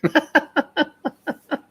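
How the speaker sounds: real high laugh; laughing about the fast ride; high burst of laughter. Real, not acted. Laughing at the speed.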